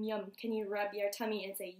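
usual speaking voice

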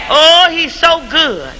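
ordinary talk, nothing marked

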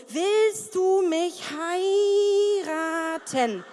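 very slow, drawn-out romantic question; slow fantasy proposal; dreamy proposal. Pretend. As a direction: savour each word.

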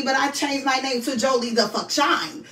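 normal talking voice

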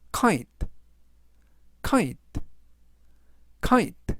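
"kite" said three times, KITE with a clear final T; pronunciation drill. Shown as a demonstration. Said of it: "라고 발음하시면 되겠고요". ("kite"를 세 번 또박또박 발음 시범)

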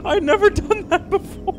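high, loud, squeaky through laughter; laughing. Real, not acted. Laughing at her own gaming blunder, high and loud. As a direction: barely get it out while cracking up.

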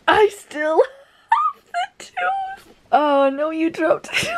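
very high, slow, breaking into laughter; laugh-crying. Real, not acted. Reader quoting Genya's tooth line, laughing hard. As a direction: giggle through the line.

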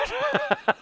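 short high-pitched laugh; high relieved laugh. Real, not acted. Laughs at lucky shot.

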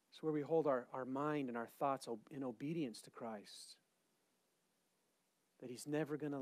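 very soft, low, intimate preaching; quiet, reverent murmur; hushed prayerful reflection. Real, not acted. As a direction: sink almost to a whisper as if praying.

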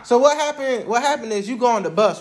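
usual speaking voice

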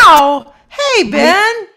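loud, high, thrilled hello; excited greeting. Real, not acted. Greets old bandmate in happy surprise.

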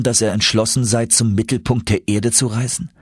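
ordinary delivery, nothing marked

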